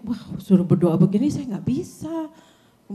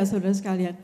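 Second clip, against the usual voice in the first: ordinary talk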